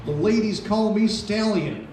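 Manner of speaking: ordinary talk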